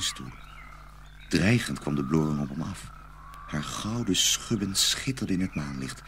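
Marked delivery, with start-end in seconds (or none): none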